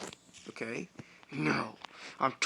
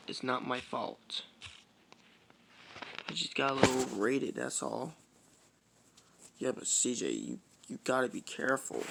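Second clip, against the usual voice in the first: ordinary delivery, nothing marked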